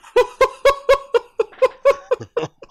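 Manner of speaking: sudden loud burst of laughter. Real, not acted. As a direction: cracks up loudly. Laughing during podcast.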